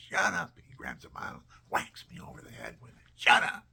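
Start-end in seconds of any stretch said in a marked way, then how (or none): 0.1-0.5 loud, higher "shut up"; retold angry yell. Bully child yells in retold scene. 3.2-3.7 very loud, sudden shout; retold angry yell. Bully child yells in retold scene.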